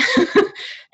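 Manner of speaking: short high burst of laughter; nervous laugh. Real, not acted. Laughing at her own sweating, a high nervous giggle. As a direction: laugh off the nerves.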